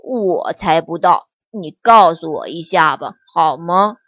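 plead, a bit troubled; frowning. Acted. Little bear begging the fox to tell, frowning. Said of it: "皱着眉头说".

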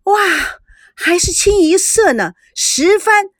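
high, excited exclaiming over the winning hand; astonished cry. A narrator's reading. Tang Ying at the mahjong table, crying out in surprise. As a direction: gasp and shout it out in amazement. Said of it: "唐英惊呼说".

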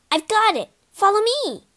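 high, excited exclamation; eager, high-pitched call to a friend; sudden inspiration. A narrator's reading. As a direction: burst out with a bright idea. Jake has a sudden idea.

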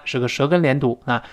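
usual speaking voice

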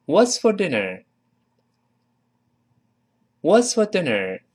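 "What's for dinner?" high, then falling at the end; model reading. Shown as a demonstration. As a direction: read "What's for dinner?" as a high, carefully linked example sentence. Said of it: "我们完整的读一下这句话".

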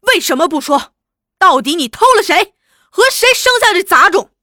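loud, fast, high accusing demands; angry interrogation. Acted. Husband furiously demanding who fathered the child. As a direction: hurl the questions at her.

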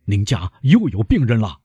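usual speaking voice

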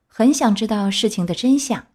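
usual speaking voice

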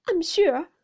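high, loud, upset opening; tearful wife. A narrator's reading. Mother going on at a disadvantage, high and tearful. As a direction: voice the mother near tears. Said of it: "with many tears".

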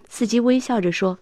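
plain reading voice

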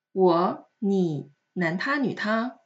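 normal talking voice

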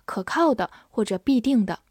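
usual speaking voice

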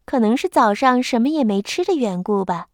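plain reading voice

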